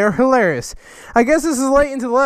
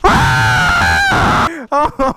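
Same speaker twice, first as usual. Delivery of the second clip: shouting laugh. Real, not acted. Reaction to sword.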